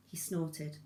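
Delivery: plain reading voice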